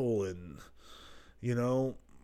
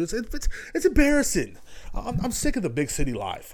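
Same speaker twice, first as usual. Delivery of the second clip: mock embarrassed whine; 9 semitones higher than usual. Real, not acted. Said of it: "It's embarrassing where I live".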